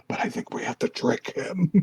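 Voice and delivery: conspiratorial whisper. Retold or imitated. Owner whispers his plan to Midori, a mock whisper. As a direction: whisper the scheme into her ear. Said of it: "whispering her in, in her ear".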